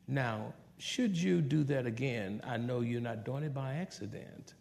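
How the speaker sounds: soft, patient parental talk; calm but firm. Shown as a demonstration. Parent correcting a child with a smile, calm and soft. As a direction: gently reason with a child, meaning business. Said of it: "be calm and mean business and tell your children with a smile".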